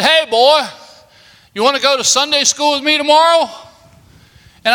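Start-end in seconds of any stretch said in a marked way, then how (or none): 0.0-3.5 stranger calling out. Retold or imitated. Van driver inviting a scared boy to Sunday school, called out high and quick. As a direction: hail the boy like a friendly stranger. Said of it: "he said".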